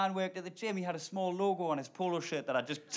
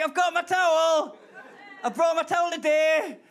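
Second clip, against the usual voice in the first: proud excited; 9 semitones higher, 7 dB louder than usual. Retold or imitated. Proudly showing the gym worker his towel, loud and high. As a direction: show off your towel like a proud child. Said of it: "I was like".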